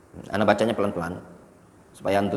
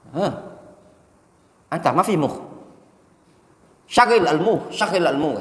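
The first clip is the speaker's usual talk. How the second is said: loud Arabic scolding, pitched up; scolding waiter; 7 semitones higher, 5 dB louder than usual. Retold or imitated. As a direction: tell him off sharply in Arabic.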